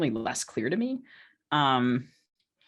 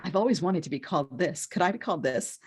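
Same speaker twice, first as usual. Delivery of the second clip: silly pleading; 4 semitones higher than usual. Retold or imitated. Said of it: "leaned into the silliness".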